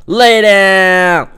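loud gamer taunt; taunting yell. Real, not acted. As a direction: yell it down at the beaten enemy. Taunting enemy in fight.

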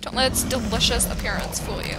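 ordinary talk

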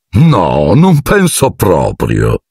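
slow, icy and smug; icy smile. A narrator's reading. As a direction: savour the refusal coldly. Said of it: "con un sorriso glaciale". (con un sorriso glaciale, lento)

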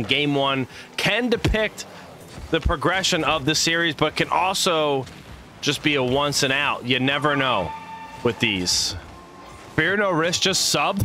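ordinary talk

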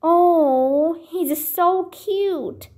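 high, slow cooing over a tiny animal. A narrator's reading. Annie coos over the cute bush baby. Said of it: "cooed Annie".

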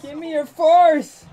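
high, loud cry for help; hammed-up desperate plea; pleading shout. Pleading to his boss Vader.

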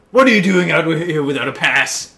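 lightly voiced stern guard; hall guard voice. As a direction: play a guard catching a student. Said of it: "It's the hall guard".

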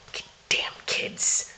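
slow unvoiced whisper; whispered grumble. Real, not acted. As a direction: grumble under your breath. Muttered complaint about the mess.

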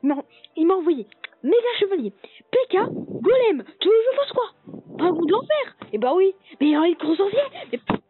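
high, loud furious whining rant; raging gamer. Acted. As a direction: rage at the game, near tears. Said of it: "Le mec qui rage quand il perd".

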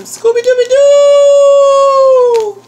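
high, loud drawn-out hoot on the last word; excited whoop. Real, not acted. Vlogger showing the candy she was sent, excited whoop. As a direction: whoop it out in delight.

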